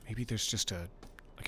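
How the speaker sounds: usual speaking voice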